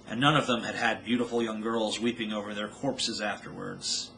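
usual speaking voice

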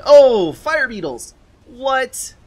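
high, loud burst of surprise; startled exclamation. Real, not acted. Game caster spots Fire Beetles out of nowhere, startled. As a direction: blurt it out, stretched and astonished.

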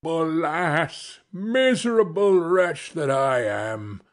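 lightly acted wail of self-pity; mild theatrical lament; crying out in despair. A narrator's reading. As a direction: bemoan your fate as the ruined carter. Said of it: "cried he".

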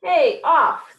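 high, loud storybook objection; indignant protest. A narrator's reading. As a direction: shoo a rival off your seat. Dog quarrels over the chair.

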